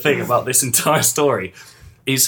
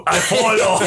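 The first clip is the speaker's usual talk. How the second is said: laughing mock grunt; 5 semitones higher, 3 dB louder than usual. Echoing the grunted line, laughing.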